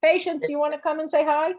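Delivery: high, coaxing call to a pet; calling the dog. Real, not acted. Owner inviting her dog to say hi, high calling voice.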